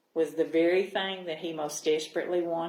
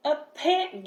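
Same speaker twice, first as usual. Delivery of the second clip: excited fish voice; 7 semitones higher than usual. A narrator's reading. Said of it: "Gilbert glubbed".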